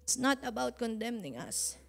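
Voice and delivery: fervent sermon, pressing each phrase; preaching. Acted. Pastor exhorting the congregation, fervent preaching. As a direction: preach it to the church with conviction.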